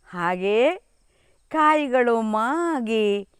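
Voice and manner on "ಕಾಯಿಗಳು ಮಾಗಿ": usual speaking voice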